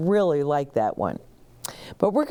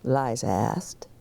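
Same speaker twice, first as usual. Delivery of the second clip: plain reading voice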